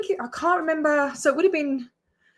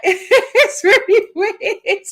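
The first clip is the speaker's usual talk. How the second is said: laughing it out, slow and higher; real amused laugh through the words; giggling disbelief at a stranger's gesture; 9 semitones higher, 7 dB louder, much slower than usual. Real, not acted.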